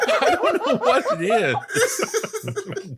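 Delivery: high, slow, laughing through words. Real, not acted. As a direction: giggle out the words. Laughing at own accent.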